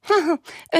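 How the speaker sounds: sudden high mocking laugh. Big brother Lali laughs at Ödönke, high mocking laugh. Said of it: "nevetett Lali".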